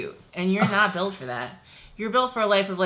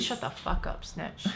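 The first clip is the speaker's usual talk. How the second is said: hissed mock scold; whispered; 2 semitones lower, 7 dB quieter than usual. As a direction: whisper it like a joke insult. Mock scolding a patron name.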